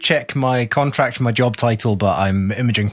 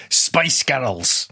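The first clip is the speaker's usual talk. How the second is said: thick Scottish accent. Shown as a demonstration. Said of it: "So in really thick Scottish accent".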